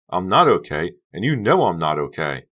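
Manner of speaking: loud, pressing anger; angry. Acted. Becca advances on Honey, loud and angry. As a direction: hammer each okay.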